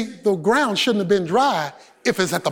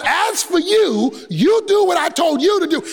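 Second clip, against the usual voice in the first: God's command; 6 semitones higher than usual. Retold or imitated. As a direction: command it with divine authority. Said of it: "God says".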